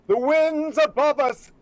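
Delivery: high, loud, drawn-out theatrical proclamation; Storm. Acted. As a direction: summon the winds, stretching every word. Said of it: "hates the voice of".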